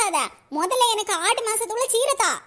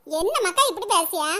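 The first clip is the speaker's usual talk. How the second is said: ordinary delivery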